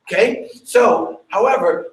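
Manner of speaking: ordinary delivery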